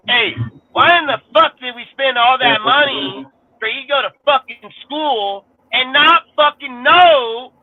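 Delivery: exasperated rant. Real, not acted. Vlogger scolding his woman about her schooling, high exasperated rant. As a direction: scold her for not thinking it through.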